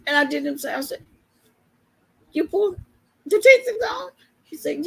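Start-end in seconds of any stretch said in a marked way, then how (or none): 2.3-4.1 mock disbelief, rising high and loud on teeth; amazed. Patient amazed her teeth are already out, mock disbelief. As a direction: ask it in wide-eyed disbelief.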